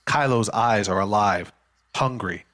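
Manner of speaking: usual speaking voice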